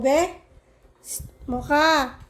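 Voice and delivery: ordinary talk, nothing marked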